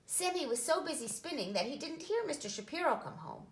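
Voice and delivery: plain reading voice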